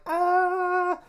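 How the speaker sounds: very high, loud squeal; joyful squeal. Retold or imitated. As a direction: burst out with overwhelmed joy. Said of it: "and I'm just like".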